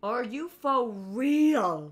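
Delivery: loud, slow, drawn-out disbelief; incredulous outrage. Real, not acted. Host protests Lego Batman's snub, loudly incredulous. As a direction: stretch every word in loud indignation.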